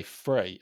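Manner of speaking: "free" for "three", starting on an F; TH-fronting. Retold or imitated. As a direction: swap the TH of "three" for F, saying "free". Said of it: "always pronounce a T H as an F".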